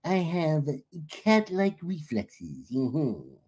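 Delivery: slow, drawn-out stoner voice; Brian Greer. Retold or imitated. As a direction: imitate a stoner friend, stretching words out. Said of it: "that's exactly how he talked".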